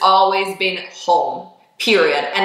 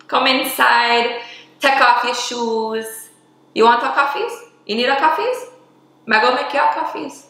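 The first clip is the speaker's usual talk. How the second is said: playful Jamaican patois; 5 semitones higher than usual. Pretend. Welcoming viewers into her home, playful Jamaican accent. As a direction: invite guests in a hammed-up Jamaican hostess voice.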